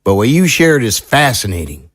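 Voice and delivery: folksy small-town sheriff, earnest. Acted. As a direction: play a seasoned sheriff weighing strange testimony. Sheriff figure in audio drama.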